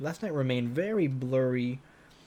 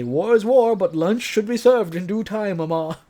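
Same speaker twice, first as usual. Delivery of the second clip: higher, louder grand declaration; mock proclamation to mom; 7 semitones higher, 6 dB louder than usual. Gamer imagining answering mom's lunch call with a grand higher declaration. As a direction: announce it like a general to your mother. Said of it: "I'm gonna say".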